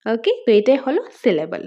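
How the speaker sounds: ordinary talk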